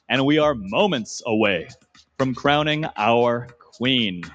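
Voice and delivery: ordinary delivery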